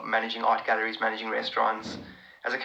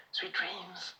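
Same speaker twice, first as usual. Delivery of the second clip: whisper; 5 semitones higher, 8 dB quieter than usual. Real, not acted. Soft goodnight to a viewer.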